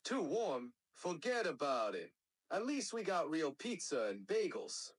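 New Yorker talking smack; New York accent character. Shown as a demonstration. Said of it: "New Yorker".

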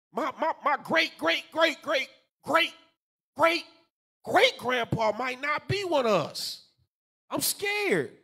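high, stuttering pretend fear; hammed-up worry, stammering on repeated words; mock scared.

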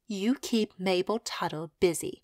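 ordinary delivery, nothing marked

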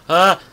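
sudden high, loud yelp of fright; real startled scream. Real, not acted. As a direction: scream as something jumps at you. Startled by a mob.

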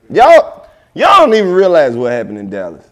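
grab everyone's attention with a big, loud "y'all"; a loud, excited "Y'all" called out to everyone; excited shout-out. Real, not acted. Building up to the Dallas story.

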